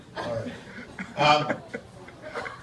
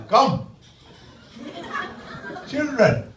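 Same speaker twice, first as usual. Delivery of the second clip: put-on Indian-accented Santa; 9 semitones higher than usual. Santa from India summoning children, high Indian accent. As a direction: call out sharply in a hammed-up Indian accent. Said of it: "There was a Santa Claus from India".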